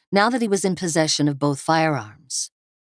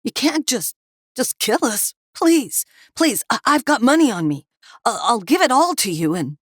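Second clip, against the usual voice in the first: high, tearful, breaking plea; terrified begging; 8 semitones higher than usual. Acted. Captive begging the meth cooks not to kill him, sobbing. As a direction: plead for your life, voice cracking. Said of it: "Harvey sobbed".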